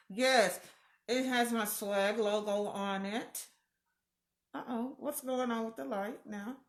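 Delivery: usual speaking voice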